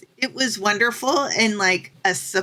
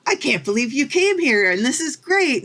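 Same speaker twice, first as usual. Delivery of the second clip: imitated pleased amazement, pitched up; higher, enthusiastic quote; retold delight; 5 semitones higher than usual. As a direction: mimic a delighted welcoming local. Imitating delighted locals welcoming her.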